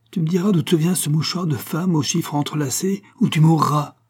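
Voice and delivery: rising, then shouting the threat loud; furious threat. A narrator's reading. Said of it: "Son œil étincelait de courroux".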